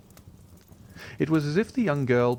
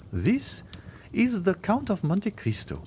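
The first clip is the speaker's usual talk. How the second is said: society lady; 11 semitones higher than usual. Acted. Baroness introduces the Count.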